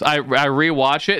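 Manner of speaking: usual speaking voice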